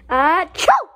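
loud exaggerated fake achoo; fake sneeze.

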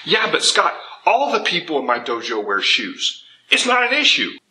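mock objection, pitched high at both ends; imitated student protest. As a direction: act out a viewer arguing back. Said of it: "you might be thinking".

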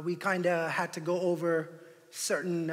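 normal talking voice

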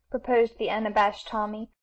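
plain reading voice

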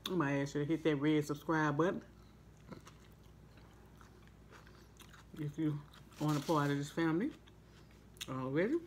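chewing while talking; talking between bites; mouth full of food. Real, not acted. Seen 2: Bites the sandwich repeatedly, then chews with mouth full throughout.

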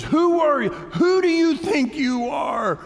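very high, slow, loud rebuke; God's outraged shout. Acted. God confronting Job, indignant shouted rebuke. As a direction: shout it down at someone who overstepped. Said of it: "type of, type of talk".

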